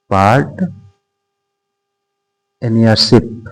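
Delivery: "Part", long pause, then "नरशिप"; halting piece-by-piece dictation, softly ended; broken-up dictation. Shown as a demonstration. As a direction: split the word after "part", as if writing it. Teacher spells out topic slowly.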